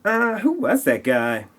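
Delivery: high puzzled guest voice; mock party guest. Voicing a confused guest after the stranger leaves, high and puzzled.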